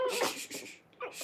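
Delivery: soft whispered shushing; whispered shush to calm puppies; soothing shush. Real, not acted. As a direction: hush the puppies gently.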